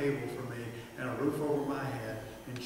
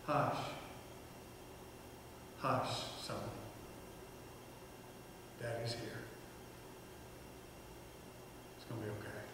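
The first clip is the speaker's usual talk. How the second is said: soft, hushed comfort, trailing off quieter; soothing hush; 2 semitones lower, 12 dB quieter than usual. Retold or imitated. Father soothing his crying little boy, softly. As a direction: soothe a crying child gently.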